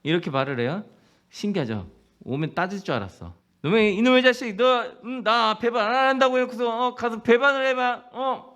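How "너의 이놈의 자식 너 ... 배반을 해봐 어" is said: high, loud mock rebuke of a traitor; mock angry scolding. Jesus berating Peter for betrayal, hammed-up anger. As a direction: scold him like a betrayed friend. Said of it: "이렇게 따져야 되잖아".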